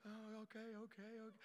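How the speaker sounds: a meek mother quietly backing down; meek mom. Retold or imitated. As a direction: give in quietly like a timid wife. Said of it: "my mom was real quiet and very meek".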